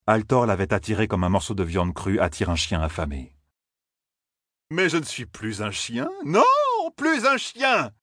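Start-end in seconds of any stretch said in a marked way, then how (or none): 4.7-7.9 rising rage. A narrator's reading. Fane raging to himself.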